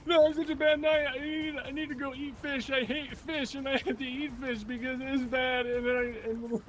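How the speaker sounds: high, whiny mock despair; hammed-up sulky knight; mock wailing. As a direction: knight whines about fish, high and laughing.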